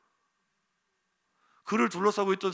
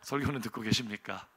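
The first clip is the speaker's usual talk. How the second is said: ordinary delivery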